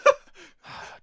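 loud, high sigh of exasperation; exasperated sigh. Real, not acted. Podcaster corrected by co-host, loud exasperated sigh.

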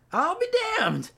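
mock folksy exclamation.

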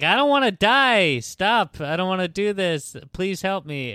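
mock frightened pleading. Unwilling woman on stage to the audience, mock pleading. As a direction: imitate a victim crying out for help.

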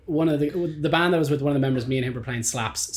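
ordinary talk

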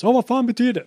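very high, loud mock outburst; retold shriek of frustration; screaming driver. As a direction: imitate a furious driver demanding an answer. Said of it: "Och bara gapar och skriker".

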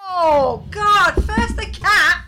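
loud, high, slow disgust; disgusted outburst. Real, not acted. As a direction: recoil loudly at the stink. Reacting to a smell.

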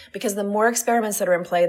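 ordinary talk, nothing marked

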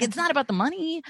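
fast high-pitched comeback, played up; exasperated retort. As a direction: squeak up high in retold exasperation. Repeats retort after laughing.

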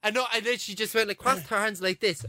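ordinary delivery

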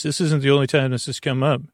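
normal talking voice

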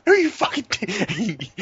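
high, drawn-out acted exasperation; hammed-up indignant denial; mock fed-up outburst. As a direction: act out someone who has had enough. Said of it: "somebody's just had enough".

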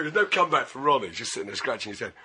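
ordinary delivery

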